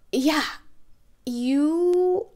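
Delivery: ordinary talk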